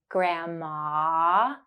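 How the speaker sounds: "Grandma" with the final ah drawn out; held ah. Shown as a demonstration. Teacher drawing out the ah in "Grandma" for learners. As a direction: stretch the last ah of "Grandma". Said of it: "To practice the ah sound".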